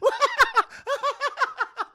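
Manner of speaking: high, loud cackling laugh; acted burst of shrieking laughter; long hammed-up high laugh; hysterical mock laugh. Selina's acted laugh at the idea.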